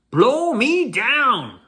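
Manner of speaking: a hammed-up pirate yelling in surprise; excited pirate cry. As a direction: bellow it in amazement, dragging each word. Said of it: "he cried".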